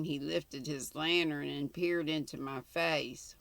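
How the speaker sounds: ordinary delivery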